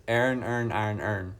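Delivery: "Iron, earn, iron, earn" run into near-identical "ern" sounds; own accented pronunciation. Shown as a demonstration. Streamer showing how they would pronounce it, accented tongue-twister. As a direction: squash each word of "Iron, earn" toward "ern". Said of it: "exactly how I'd pronounce it".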